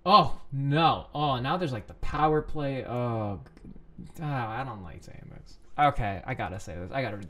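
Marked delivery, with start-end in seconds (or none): none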